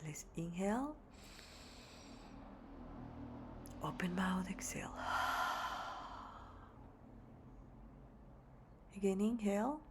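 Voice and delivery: very soft, hushed meditation voice; guided meditation. Real, not acted. Yoga teacher settling the class to meditate, hushed guided meditation. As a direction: guide them gently into stillness, leaving long pauses.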